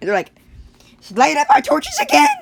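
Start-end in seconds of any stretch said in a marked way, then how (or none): 1.1-2.3 high, loud rallying yell; hammed-up villagers' war cry; mock battle cry. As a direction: shout it like a villager rousing the mob. Villagers calling out to attack.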